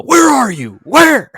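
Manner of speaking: high, very loud mock holler; hammed-up searching shout; imitated yell. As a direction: yell as if calling for someone lost. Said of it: "listening to you yell".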